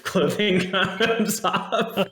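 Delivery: high-pitched, breaking into laughter; words squeezed out through a laugh. Real, not acted. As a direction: crack up while finishing the joke. Cracks up at own joke.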